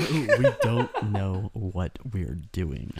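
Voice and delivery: low, slow mock-spooky echo. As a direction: ham it up, drawn out and low. Mocking echo of partner.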